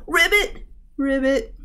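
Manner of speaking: high-pitched frog ribbits; frog croak. A narrator's reading. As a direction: chirp it high like a hopping frog. Frog hops away croaking.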